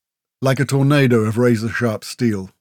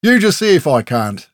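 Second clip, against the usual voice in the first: loud, raised challenge; barked; 5 semitones higher, 6 dB louder than usual. A narrator's reading. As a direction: bark a defiant dare. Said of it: "Grell barked".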